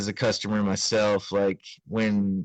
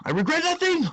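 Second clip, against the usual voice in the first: far higher, hammed-up proclamation; dramatic declaration. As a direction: declare it like a movie hero.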